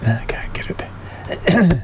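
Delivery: frustrated whisper under breath. Real, not acted. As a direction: whisper it to yourself, slowly. DJ can't find the track.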